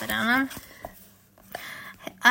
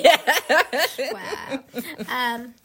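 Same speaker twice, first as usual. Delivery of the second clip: ordinary delivery, nothing marked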